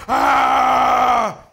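loud mock Hulk roar, shouted; Hulk growl. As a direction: roar it out like a kid playing Hulk. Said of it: "he growls".

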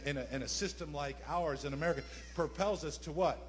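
normal talking voice

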